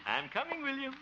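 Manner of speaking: put-on high sing-song call to the boy. Harold calling up to little William. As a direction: call him sweetly from afar.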